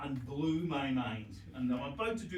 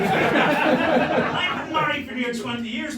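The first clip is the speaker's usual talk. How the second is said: slow and louder, through laughter; laughing; 9 semitones higher, 12 dB louder, much slower than usual. Real, not acted. Husband of twenty years laughs at his own ignorance. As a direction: drag it out while cracking up.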